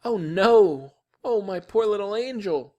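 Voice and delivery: high, loud cry of dismay; horrified mother. A narrator's reading. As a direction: wail over your poor baby. Mother learns toddler lost his teeth.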